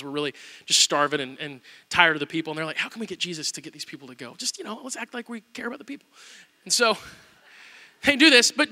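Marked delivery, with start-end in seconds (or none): 2.8-6.1 much softer, scheming aside; conspiring hush. Retold or imitated. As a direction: hatch a plan under your breath. Disciples scheming among themselves.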